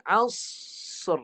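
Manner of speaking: Asr with a bounced sad. Shown as a demonstration. Teacher showing qalqalah on sad, bounced. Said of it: "Sad dia ada qalqalah, dia pantulkan".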